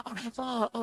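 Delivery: normal talking voice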